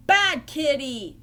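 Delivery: loud, slow scolding; angry scream. Acted. Ernie rages at the kitten over his duck, screaming angrily. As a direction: scold loudly, stretching out each word. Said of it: "he screamed".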